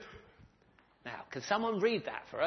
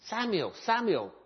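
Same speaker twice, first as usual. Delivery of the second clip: calling out; 7 semitones higher, 8 dB louder than usual. Acted. Voice calls Samuel at night.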